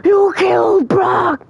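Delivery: very high, drawn-out wail of protest; anguished outcry. Retold or imitated. Fan reliving Brock's death, anguished high wail. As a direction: howl it high, stretching every word. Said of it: "I was like".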